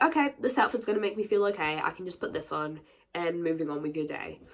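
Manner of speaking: normal talking voice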